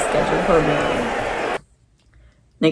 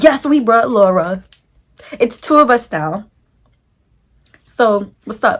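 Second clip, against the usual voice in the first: louder, higher taunting call; loud challenge; 4 semitones higher, 9 dB louder than usual. Retold or imitated. Yelling up at the ex and his friends, loud and taunting. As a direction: call it up the stairs like a dare. Calling up at ex's door.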